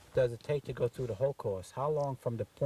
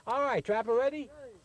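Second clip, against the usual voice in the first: raised outdoor call; 10 semitones higher than usual. Real, not acted. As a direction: shout the command to the trap. Shooter calls for target.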